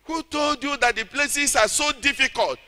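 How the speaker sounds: ordinary talk, nothing marked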